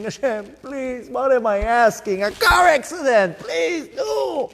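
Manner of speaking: high, pleading, mock-frantic prayer; hammed-up plea, pitch climbing to a yelp; mock panicked prayer. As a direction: play a man begging God then recoiling. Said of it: "and saying".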